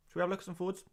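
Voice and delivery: lightly put-on nerdy stats presenter; hint of a dry data-nerd announcer; statistics voice. Shown as a demonstration. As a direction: put on a mock serious stats voice. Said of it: "you reckon I could do a whole stream talking like that".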